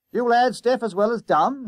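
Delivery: loud, high-pitched challenge; exasperated conductor. A narrator's reading. Conductor confronting two schoolboys, loud exasperation. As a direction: demand it loudly of the lads.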